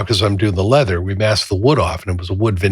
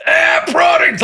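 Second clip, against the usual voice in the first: angry yell; slower than usual. Retold or imitated. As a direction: scream it at full force. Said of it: "It went from".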